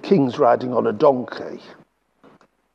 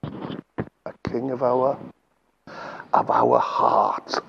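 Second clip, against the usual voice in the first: emotional; 6 semitones lower, 8 dB quieter, slower than usual. Real, not acted. Emotional sermon climax.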